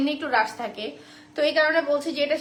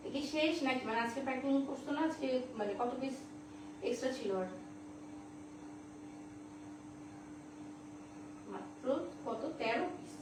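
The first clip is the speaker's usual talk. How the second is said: much softer, off to the side; quiet phone aside; 14 dB quieter than usual. Real, not acted. Seller checks stock with a colleague by phone, quietly aside.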